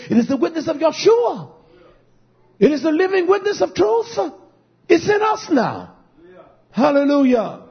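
high, fast preaching peak; rousing pulpit proclamation; fervent preaching. Acted. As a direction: proclaim it urgently, voice pitched high. Preaching climbs in fervour.

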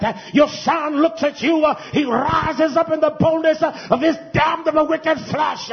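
high, driving sermon delivery with punched phrases; forceful, authoritative preaching. Real, not acted. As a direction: preach it demonstratively, pitch climbing as you go. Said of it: "a more forceful, authoritative way".

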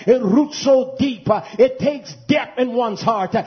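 high, driving sermon delivery with punched phrases; forceful, authoritative preaching. Real, not acted. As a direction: preach it demonstratively, pitch climbing as you go. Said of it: "a more forceful, authoritative way".

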